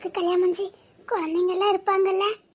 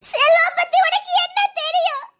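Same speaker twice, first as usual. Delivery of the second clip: much higher and louder, crying out; anguished shout; 7 semitones higher, 6 dB louder than usual. Acted. Grieving wife to her friend, anguished shout. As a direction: demand it in despair. Woman shouts about Selva.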